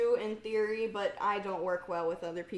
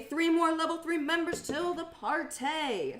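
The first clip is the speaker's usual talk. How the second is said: higher-pitched hype call; giddy party announcement; excited announcement; 8 semitones higher than usual. Real, not acted. Excitedly reads out membership milestone.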